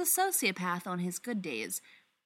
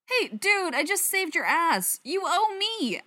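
indignant; 10 semitones higher, 5 dB louder than usual. Acted. Stiles protests Derek driving.

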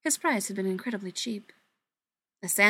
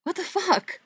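much higher, louder disbelieving cry; high disbelief; 10 semitones higher, 4 dB louder than usual. Acted. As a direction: voice jumps up in disbelief. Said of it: "his voice high and more than a little disbelieving".

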